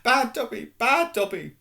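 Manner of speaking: high, loud self-scolding elf cries; shouting. Acted. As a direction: punish yourself out loud.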